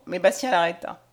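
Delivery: usual speaking voice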